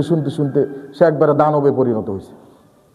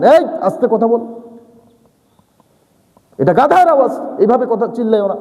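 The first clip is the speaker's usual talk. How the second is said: scolding; 5 semitones higher than usual. Acted. Preacher voicing a father's scolding of a servant. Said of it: "আল্লাহ তার বান্দাকে ধমকাচ্ছেন".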